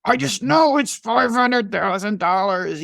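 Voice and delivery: mock stubborn lawyer. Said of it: "And he's like".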